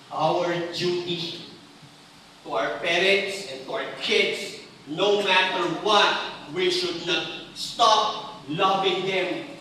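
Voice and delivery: drawn out, every word weighed; slow down and lean on each word; slow, deliberate sermon emphasis; slow emphatic preaching. Real, not acted.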